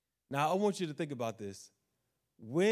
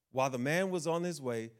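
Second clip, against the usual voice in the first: ordinary talk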